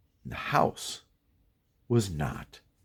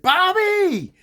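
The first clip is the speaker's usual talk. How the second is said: high, loud cry of a name; alarmed shout; 6 dB louder than usual. Retold or imitated. Kid turning back to his trapped friend, alarmed shout.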